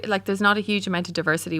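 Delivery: ordinary talk, nothing marked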